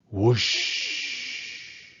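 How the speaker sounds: breathy unvoiced wind rush; whooshing wind. A narrator's reading. Spelling the sound of the wind, breathy whoosh. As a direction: blow it like cold wind. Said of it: "the sound of the wind".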